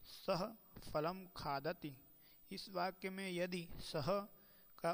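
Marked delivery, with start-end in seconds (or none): 0.0-2.0 slow careful demo. Shown as a demonstration. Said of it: "निम्नलिखित संस्कृत वाक्य का उच्चारण करना है".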